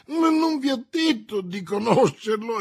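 high, loud desperate protest. A narrator's reading. Prisoner protesting to the commissioner, desperate. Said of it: "esclamò disperato Bonacieux".

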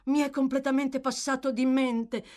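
high, absent-minded surprise; innocent exclamation. Acted. Absent-minded old gentleman remembers the unpaid book, innocently exclaiming. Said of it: "esclamò nel tono dell'innocenza".

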